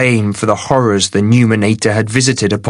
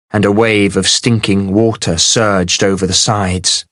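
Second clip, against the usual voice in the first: ordinary delivery, nothing marked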